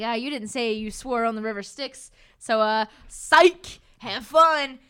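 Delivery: building to a loud slowed psych; gloating taunt. Retold or imitated. Geryon taunting Percy that he never swore, loud gloat.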